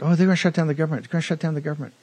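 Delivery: a lightly voiced fast, childish whine; mock the panicky pundits like little kids, rattling it off; mocking whiny children. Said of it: "Like little children".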